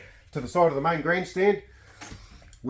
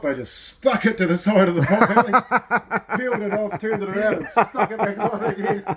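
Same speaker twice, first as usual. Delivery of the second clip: high voice breaking up with laughter; laughing storytelling; 6 semitones higher than usual. Real, not acted. Man telling his mate a camping story, laughing helplessly. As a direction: struggle to get the story out through laughs.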